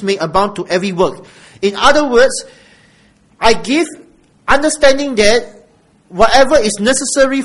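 emphatic preaching. Real, not acted. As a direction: drive the promise home to the congregation. Preaches emphatically at full force.

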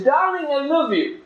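high, syrupy "Darling, I love you"; a wife's sugary greeting, acted; sweet wife voice. Wife voiced in sermon story.

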